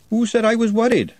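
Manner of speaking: defensive snap at Nutt; snapped. A narrator's reading. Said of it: "Glenda snapped".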